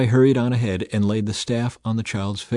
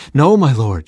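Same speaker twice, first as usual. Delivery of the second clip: raised, louder cry of disbelief; crying out in protest; 4 semitones higher, 8 dB louder than usual. A narrator's reading. Woman to the man of God, crying out in protest. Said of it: "she cried".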